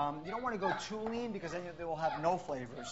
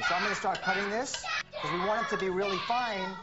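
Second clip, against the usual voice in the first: talking over noise; 4 semitones higher, 5 dB louder than usual. Real, not acted. Talking over screaming kids.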